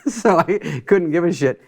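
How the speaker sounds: laugh, then talk through it. Real, not acted. Comedian mocking own ignorance of politics, laughing.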